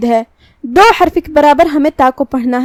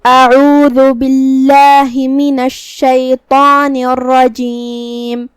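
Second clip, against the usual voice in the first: slow, drawn-out melodic tilawat; Quran recitation; much slower than usual. Shown as a demonstration. Teacher recites the verse for students, slow melodic chant. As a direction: chant each word long and measured like a qari.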